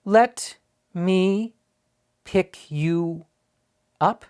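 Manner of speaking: high, slow, word by word; very slowly. A narrator's reading. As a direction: spell it out slowly like to a foreigner. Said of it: "said very slowly".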